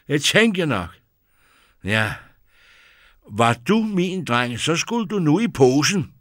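mother of the winds threatening the prince with the sack; angry scolding. A narrator's reading. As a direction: threaten him like a naughty boy. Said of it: "Hun så vred ud".

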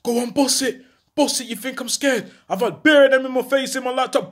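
high shouted dare to a gunman; retold shouting. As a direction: yell it out like you're not scared. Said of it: "I can hear him shouting".

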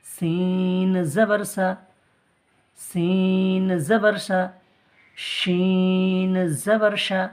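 slow, drawn-out chant of the syllable; recitation drill. Shown as a demonstration. Teacher chants letter drills slowly for the class. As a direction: chant it slowly for students to copy. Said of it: "এগুলো সব আকার".

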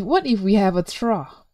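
ordinary delivery

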